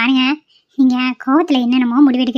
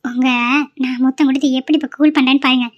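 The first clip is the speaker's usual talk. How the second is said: usual speaking voice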